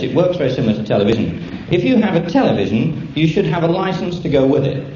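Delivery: usual speaking voice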